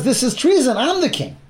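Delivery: high-pitched outcry at no extra volume; mock scream. Usurping queen discovers the new king, mock scream. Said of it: "she screams".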